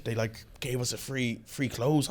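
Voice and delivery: ordinary talk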